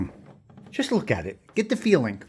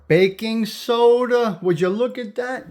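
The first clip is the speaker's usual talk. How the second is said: drawn-out, higher, slowly savoured; amused surprise; 6 semitones higher, slower than usual. Real, not acted. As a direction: stretch it out in delighted disbelief. Amazed at old baking soda.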